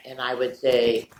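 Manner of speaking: ordinary delivery, nothing marked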